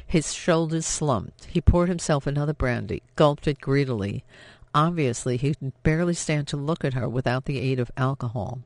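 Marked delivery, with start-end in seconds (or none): none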